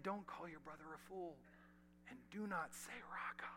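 soft, hushed quote of Jesus; near-whispered words of Jesus. Acted. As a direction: whisper the command like a secret.

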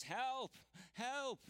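high, loud yell for help, as if hurt and alone; retold scream for help. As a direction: recreate the desperate cry from under the tree. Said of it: "I screamed at the top of my lungs".

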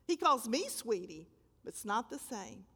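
ordinary delivery

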